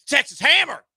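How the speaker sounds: hammed-up injury lawyer ad, loud; a hint of a late-night lawyer commercial; mock ad lawyer. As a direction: shout it like a TV lawyer ad. Parody lawyer ad.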